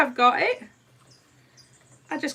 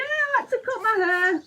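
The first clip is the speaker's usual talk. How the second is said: ordinary delivery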